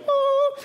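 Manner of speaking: mock-miraculous high, loud "ohhh"; high held "oh". Preacher imagining Jesus healing everyone at once, high held "oh". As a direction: hold one high note like a magic effect.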